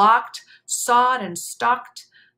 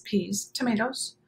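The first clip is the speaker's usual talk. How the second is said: ordinary delivery, nothing marked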